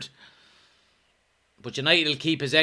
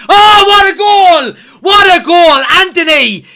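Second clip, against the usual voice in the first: screaming high and loud with joy; ecstatic goal shout; 13 semitones higher, 13 dB louder, slower than usual. Real, not acted. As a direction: roar the goal at the top of your voice. Team scores a goal.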